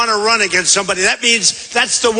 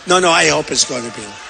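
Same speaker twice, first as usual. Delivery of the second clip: emphatic repeat; 6 semitones lower, faster than usual. Retold or imitated.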